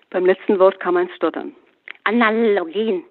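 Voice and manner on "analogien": slow, stuttering mock of the word "Analogien"; mocking imitation. Sister Emma mockingly parrots her brother's "Analogien". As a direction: drag out "Analogien" like the brother did, teasing. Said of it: "äffte Emma ihren Bruder nach".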